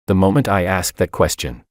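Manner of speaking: usual speaking voice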